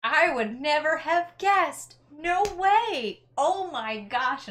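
high, loud, slowed mock gushing; hammed-up delight over meeting rangers; big fake excitement. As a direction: overplay the amazement. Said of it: "I just make this big deal out of it".

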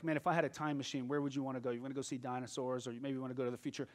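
soft, fast musing; daydreaming chatter. Retold or imitated. Someone idly imagining a time machine, quick and soft. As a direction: rattle it off quietly.

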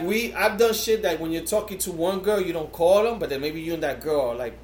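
normal talking voice

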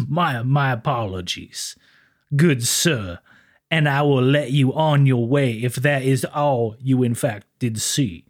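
slow, smooth, polite officer. Retold or imitated. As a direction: ease the words out like a courtly lawman. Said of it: "that smooth voice here".

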